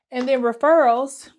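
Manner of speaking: breathless, pushing the words out; out of breath. Real, not acted. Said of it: "why am I out of breath".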